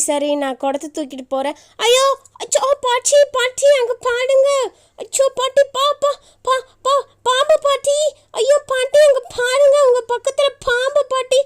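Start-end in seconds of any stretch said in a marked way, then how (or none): 1.9-11.4 high, urgent cries of alarm; panicked snake warning. Acted. Girl warns grandmother of a snake beside her, panicked. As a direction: call out in rising panic, words stumbling.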